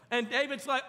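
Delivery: normal talking voice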